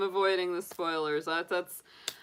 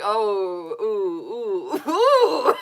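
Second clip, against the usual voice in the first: rising, louder laughing oohs; impressed ooh; 3 semitones higher, 7 dB louder, much slower than usual. Retold or imitated. Reacting to Aunt Polly's move with rising oohs. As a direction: ooh with growing glee. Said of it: "I was like".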